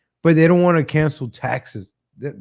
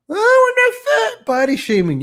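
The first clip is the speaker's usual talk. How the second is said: mock offended woman; 4 dB louder than usual. Mocking women who deny being fat, in a high squeaky falsetto.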